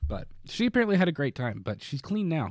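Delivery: usual speaking voice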